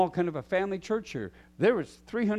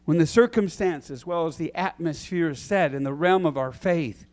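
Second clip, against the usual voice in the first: normal talking voice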